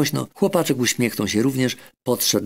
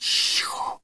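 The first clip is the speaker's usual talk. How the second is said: whispered shush; hushing admonition; 5 dB quieter than usual. Acted. Doctor hushing the boy so the shy creature won't hear, whispered. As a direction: hush him gently in a whisper. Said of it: "upominał go doktor".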